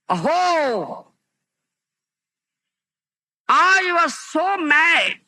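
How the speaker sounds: a lightly acted cry of remorse; regretful king. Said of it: "He says".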